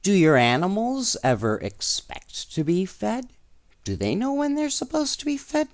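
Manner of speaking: usual speaking voice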